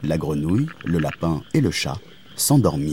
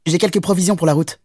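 quick, high rabbit voice; petit lapin; 9 semitones higher, faster than usual. Acted. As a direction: chirp it fast as a little rabbit. Le lapin offre ses carottes. (petit lapin, aigu et rapide)

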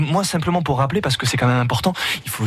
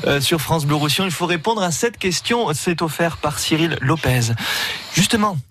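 booming prize announcer; 8 semitones higher than usual. Acted. Radio host announces the contest.

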